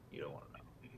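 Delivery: hushed whispered aside. Pretend. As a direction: whisper it like a secret. Aside in mock ad.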